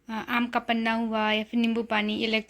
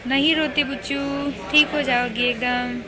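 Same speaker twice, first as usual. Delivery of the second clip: coaxing, sing-song comfort, a bit loud; gentle reassuring coaxing; 6 dB louder than usual. Real, not acted. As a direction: hush a tearful little one and promise her sister is coming. Comforting a crying little girl.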